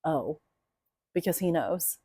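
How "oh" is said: deflated oh. Retold or imitated. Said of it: "just says".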